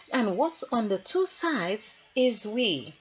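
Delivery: ordinary delivery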